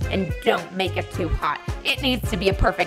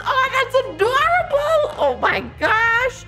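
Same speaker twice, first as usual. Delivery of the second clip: much higher, louder, slow gush; 4 dB louder, slower than usual. Acted. Karen gushing over the adorable little guy. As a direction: squeal with delight.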